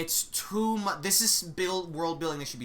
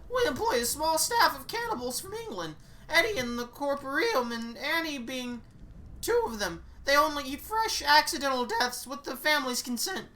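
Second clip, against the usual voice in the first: raised, brisk know-it-all guide; tour guide voice; 9 semitones higher than usual. Acted. Guide explaining the strange school to the new witch, brisk and raised. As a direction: rattle off the lore like a cheery guide.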